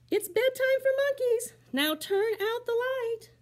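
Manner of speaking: be the firm bedtime mama. Acted. Mama voiced in story.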